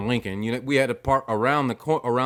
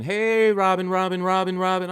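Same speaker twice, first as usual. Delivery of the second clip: higher, lightly sung call of a name; imitating wife calling; 10 semitones higher, 4 dB louder than usual. Husband imitating his wife calling to a lady. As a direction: call out like summoning a bird. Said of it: "she kept saying".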